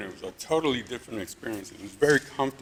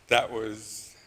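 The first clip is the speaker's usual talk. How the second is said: normal talking voice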